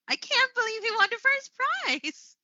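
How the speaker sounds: high-pitched amazed exclamation, held up until "prize"; demonstrating astonished excitement, voice soaring; excited disbelief. Shown as a demonstration. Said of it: "with the excitement, the disbelief, uh, from my voice".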